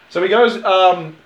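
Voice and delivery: ordinary talk, nothing marked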